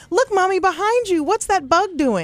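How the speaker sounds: put-on high, small child voice; playing a three-year-old pointing out a bug; toddler voice. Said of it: "three-year-old Jake goes".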